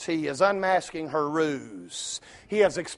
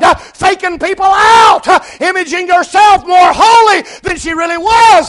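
full-force preaching; 10 semitones higher, 13 dB louder than usual. Acted. Preacher proclaiming the woman's and Jesus's words, full-force shouting. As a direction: shout the verse with long held words.

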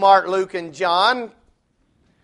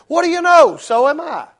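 higher, gleeful mock amazement; delighted surprise; 8 semitones higher, 3 dB louder than usual. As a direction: act thrilled at the coincidence. Man voiced, delighted.

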